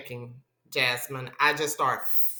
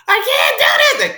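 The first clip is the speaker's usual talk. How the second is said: high, loud panicked wail; high, loud wail to the doctors before the epidural; panicked cry; 10 dB louder, much faster than usual. Retold or imitated. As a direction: cry out that you can't go on. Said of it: "I was like".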